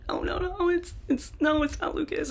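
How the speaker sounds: high, wailing sob-talk; imitating crying. Sister on the phone, crying about the death. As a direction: mimic her choked crying through the words. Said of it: "she's, like, crying".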